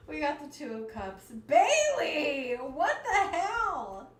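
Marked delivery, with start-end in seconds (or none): 1.5-4.1 delighted disbelief at the card; real shocked laugh. Real, not acted. Reader shocked by the card, shouting with a laugh. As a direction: shout the name, much louder and higher, laughing. Shocked by drawing Two of Cups.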